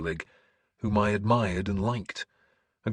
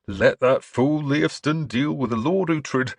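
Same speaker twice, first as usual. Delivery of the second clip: slow, raised, louder mock verdict; higher, emphatic churchman voice; bishop quoting others; 7 semitones higher, 5 dB louder than usual. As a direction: pronounce it slowly as a mocking quote. Bishop mimics those who sent him.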